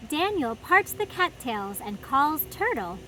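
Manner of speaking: put-on lively read-aloud for little kids; storybook reading. A narrator's reading. Host reading a picture book to children, animated storytime voice. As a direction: read it like story time in the garden.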